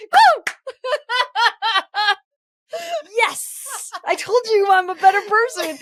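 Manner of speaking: high, laughing victory cheer; triumphant whoop. Real, not acted. Proud of her comeback to the friend, a high laughing whoop. Celebrating her own reply.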